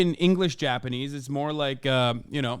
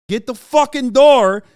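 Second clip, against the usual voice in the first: shouting to be heard; loud angry yell; 7 semitones higher, 10 dB louder than usual. Retold or imitated. Yelling at roommate for door.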